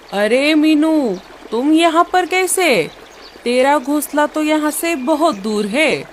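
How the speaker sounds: calls out, surprised to meet her; surprised greeting. Acted. Rano's mother surprised to meet Meenu on the way.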